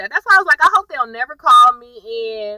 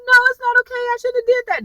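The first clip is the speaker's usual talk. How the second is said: high, upset wail, much higher; wailing protest; faster than usual. Retold or imitated. Maggie wailing to Landon, high upset voice.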